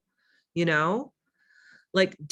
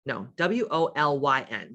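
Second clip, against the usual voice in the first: ordinary talk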